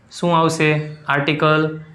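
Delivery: ordinary talk, nothing marked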